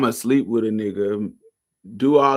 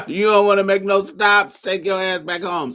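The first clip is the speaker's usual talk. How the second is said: laughing mockery; 8 semitones higher, 4 dB louder than usual. Real, not acted. Laughing repeat of his words.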